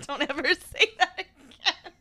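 laughing protest; high laughing plea. Real, not acted.

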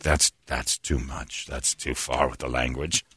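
low, slow, soft reproach; retold quiet dismay. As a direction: voice dropped as if appalled. Retells comedian's shocked reaction.

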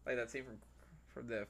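ordinary delivery

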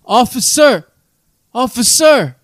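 imitating a drunk calling out high; a drunk guy in a cell calling the officer over and over; calling for the guard. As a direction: repeatedly call the guard, each time falling off. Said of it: "being like".

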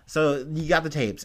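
ordinary talk, nothing marked

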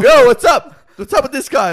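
very loud, high-pitched protest; retold alarmed shout. Clubgoer to the woman about the gorilla guy, mock alarmed shout. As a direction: yell it in hammed-up alarm.

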